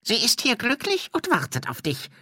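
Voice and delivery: high-pitched spirit voice; put-on high ghost voice; séance ghost. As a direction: recite a stock message as a high-voiced ghost. Ghost gives stock séance answer.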